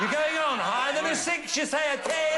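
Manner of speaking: rally the audience with each call; excited game show calling. Real, not acted. Host calls card game moves.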